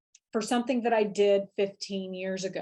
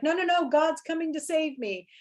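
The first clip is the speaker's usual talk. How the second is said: pitched-up insistent refusal; high voiced stubborn man; 7 semitones higher than usual. Man in the flood waving off the helicopter, pitched up and insistent. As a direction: insist God will save you, voice up high. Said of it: "And he's like".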